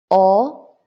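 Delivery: O rising, loud; second tone. Shown as a demonstration. Teacher drilling second line, o rising. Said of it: "And then the second line".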